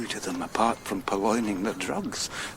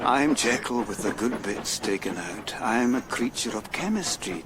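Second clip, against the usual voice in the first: usual speaking voice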